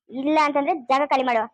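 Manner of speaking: ordinary talk, nothing marked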